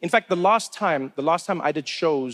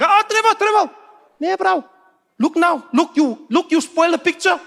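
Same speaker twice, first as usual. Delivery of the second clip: loud, high-pitched scolding; high, indignant South African fan; exasperated street fan; 12 semitones higher, 6 dB louder than usual. Acted. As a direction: protest as if the photo is ruined.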